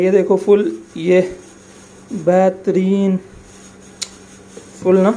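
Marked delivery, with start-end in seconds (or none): 2.1-3.2 बेहतरीन stretched very slowly; slow, relishing बेहतरीन; drawn-out praise. Real, not acted. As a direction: savour the word बेहतरीन, drawing it out slowly. Praising his own shake.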